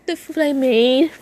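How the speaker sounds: high, plaintive question; sad. Acted. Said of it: "looked at them sadly".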